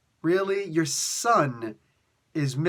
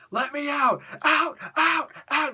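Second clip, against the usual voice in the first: high-pitched caged berserker yell; screaming; 12 semitones higher than usual. Retold or imitated. Berserker Wolverine banging against the bars, screaming. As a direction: imitate a desperate scream to be freed. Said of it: "He's just screaming".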